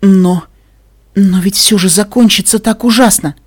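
high, loud stammered objection; distressed protest. A narrator's reading. Listener fears the ending, distressed. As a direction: plead with halting starts.

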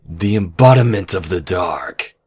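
loud villainous declaration; dark ruler proclaiming loudly; forceful proclamation. Acted. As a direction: burst out of the whisper into full voice. Villain's invocation swells.

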